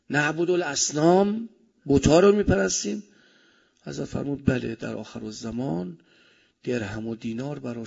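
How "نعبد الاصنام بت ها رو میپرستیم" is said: imitating a startled question, high and loud; incredulous question. Companions ask the Prophet about worshipping idols, incredulous. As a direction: raise it into a louder, astonished question.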